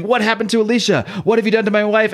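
playing a furious husband, half in jest; a husband demanding where his wife is, in a mock yell; retold scream. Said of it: "He's screaming".

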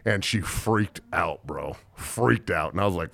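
usual speaking voice

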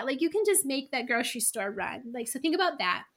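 ordinary talk, nothing marked

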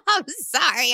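slow, giggling through the words; can't stop laughing while apologising; laughing apology. Real, not acted. To the viewers, apologising through real laughter. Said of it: "I'm laughing".

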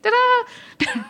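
high-pitched ta-da; a little fanfare to present the handbook. Pretend. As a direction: sing out a high ta-da. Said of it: "introduce a handbook with a da-da".

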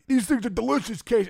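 Nuxie. Retold or imitated. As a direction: mimic an older man losing control of his mouth. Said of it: "start losing control of your mouth".

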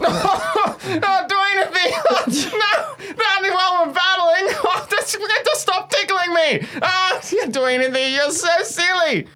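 high giggling laughter; being tickled. Acted. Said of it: "you are going to begin cackling with laughter".

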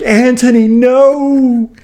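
hammed-up movie-goer grief; mock anguished cry. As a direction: cry out as if your pet just got shot. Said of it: "you're like".

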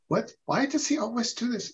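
high-pitched, puzzled complaint; mock bafflement. Second-language listener puzzled by an American's small talk, mock bafflement.

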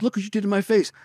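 high-pitched mock protest; whining complaint. Beaten man to his attacker, high acted complaint.

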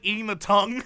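ordinary delivery

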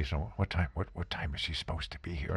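hushed, fast whisper. Retold or imitated. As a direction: lean in and whisper it urgently. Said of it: "whispering to me".

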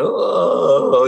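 drawn-out groan of someone winded. Retold or imitated. Fallen jockey lying face down, mock winded groan. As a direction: imitate having the breath knocked out. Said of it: "and I'm winded".

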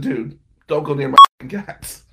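loud warning. Retold or imitated. Narrator warns porn-filming roommate, loud firm warning. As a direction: bark it as a warning to back off.

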